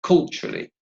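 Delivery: "Culturally" modelled with first-syllable stress; "Culturally" strong at the front, lighter after. Shown as a demonstration. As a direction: lean on the opening syllable of "Culturally". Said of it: "stress is on the first syllable".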